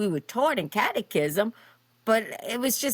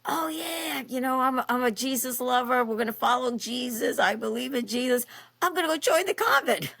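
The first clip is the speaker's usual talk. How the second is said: much higher, gushing newly devout voice, mocking herself; mock zealous convert; 6 semitones higher than usual. Former bartender mocks her newly converted self, high gushing. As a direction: play your past self as an over-the-top Jesus lover. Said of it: "I went from one extreme to the other extreme".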